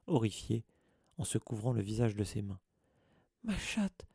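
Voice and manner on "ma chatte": high, anguished wail over his cat; a distraught old caretaker crying out for his cat. A narrator's reading. Caretaker finding his stricken cat, high anguished wail. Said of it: "hurla-t-il".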